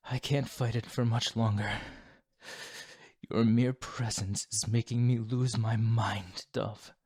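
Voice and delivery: tempted vampire, mounting strain; strained craving. Acted. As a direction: push it out as self-control slips. Vampire losing control near prey.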